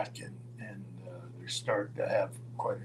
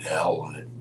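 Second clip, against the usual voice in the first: reckless resolve; 8 semitones lower than usual. Retold or imitated. Reckless driver decides at yellow light.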